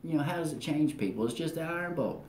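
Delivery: puzzled inner thought. Retold or imitated. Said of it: "sitting there thinking".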